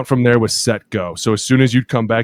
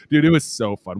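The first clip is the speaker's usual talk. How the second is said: ordinary talk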